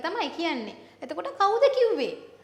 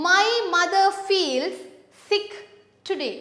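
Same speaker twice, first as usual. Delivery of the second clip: sentence read slowly, louder; slow emphatic reading; 2 semitones higher, 3 dB louder, slower than usual. Shown as a demonstration. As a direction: spell out each word slowly.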